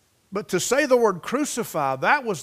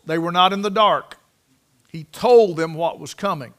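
ordinary talk

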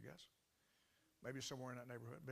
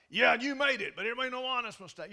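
loud, higher, scornful retort; irate; 8 semitones higher, 15 dB louder than usual. Retold or imitated. Angry landlady rejects the pastor's excuse, loud and scornful. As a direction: throw his excuse right back at him. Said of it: "she's irate".

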